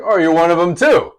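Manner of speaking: high, loud mock dismay; exclaimed realization. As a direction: blurt it out as a loud discovery. Acted reaction to a second such accent.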